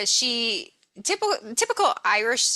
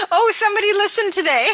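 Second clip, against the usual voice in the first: much higher and louder, gushing; mock excited; 10 semitones higher, 6 dB louder than usual. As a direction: squeal it like tiny news is huge. Mock thrilled about a listener.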